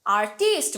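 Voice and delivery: Artist as ar-TEEST; stress on second syllable. Shown as a demonstration. Teacher repeating what not to say, artist as ar-TEEST. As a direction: lean on a long টি in artist. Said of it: "artist বলি না".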